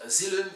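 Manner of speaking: 'asylum' as a-ZIL-um, with z and short i; wrong 'asylum', like a-ZILL-um; mispronounced asylum. Shown as a demonstration. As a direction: say 'asylum' with a buzzing z and the i of 'hill'. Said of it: "It's not asylum".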